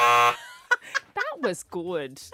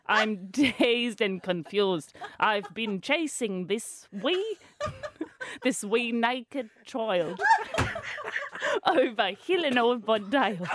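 attempted Scottish accent. Embarrassed host tackling the clip, laughing Scottish accent. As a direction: struggle through a bad Scottish accent, giggling. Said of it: "about to tackle this one".

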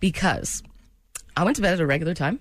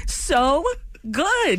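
excited; 10 semitones higher than usual. Real, not acted. Praises the impression.

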